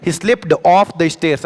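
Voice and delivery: very slow, each word set apart; slow dictation. Shown as a demonstration. Teacher presenting his chosen sentence to students, very slow. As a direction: lay out every word for the class. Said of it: "इसीलिए मैं ये sentence लेकर आया हूँ".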